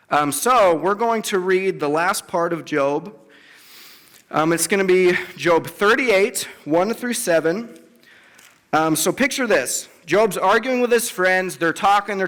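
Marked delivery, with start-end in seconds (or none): none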